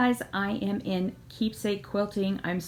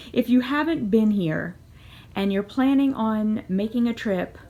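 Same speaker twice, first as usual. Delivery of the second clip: ordinary talk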